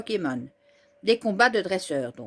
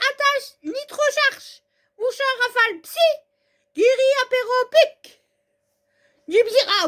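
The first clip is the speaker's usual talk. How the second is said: high, loud commands shouted; battle commands; 10 semitones higher, 5 dB louder than usual. Acted. Trainer shouting attack orders to Pokémon mid-battle, loud. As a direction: bark out each attack.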